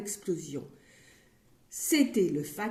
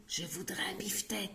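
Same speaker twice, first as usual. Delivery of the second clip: soft whispered request; 10 dB quieter, faster than usual. Acted. Monsieur Silence whispering his order to the butcher. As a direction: breathe it timidly. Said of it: "murmurait Monsieur Silence".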